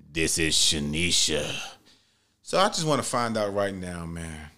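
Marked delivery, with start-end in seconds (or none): 0.1-1.5 much lower, slow mock deep voice; deep-voiced woman. Date calmly gives her name in a mock deep voice. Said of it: "and her voice was deep as fuck".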